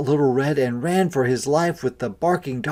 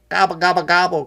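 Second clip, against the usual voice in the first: loud gobbling turkey; turkey gobble; 6 semitones higher, 6 dB louder, much faster than usual. A narrator's reading. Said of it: "cried three big turkeys".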